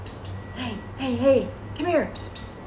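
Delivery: unvoiced hushed call; stage whisper. Shown as a demonstration. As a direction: whisper to call someone over. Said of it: "psst is used more for".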